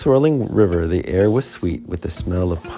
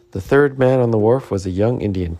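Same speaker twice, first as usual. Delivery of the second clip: usual speaking voice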